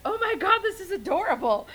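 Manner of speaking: high, loud delighted gush; excited gush. Retold or imitated. Voice actress gushing over a fan's wall scroll, excited high squeal. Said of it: "she was like".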